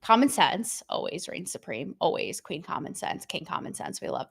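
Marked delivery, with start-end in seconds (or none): 2.4-4.3 whispered. Acted. Mock reverent royal titles.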